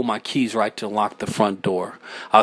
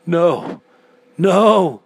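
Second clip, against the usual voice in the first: building from raised voice to high yell; anguished yell; 8 semitones higher, 7 dB louder, much slower than usual. A narrator's reading. Narrator refusing the dark voice's claim, anguished yelling. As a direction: cry out louder and higher each time. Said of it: "I yelled in anguish".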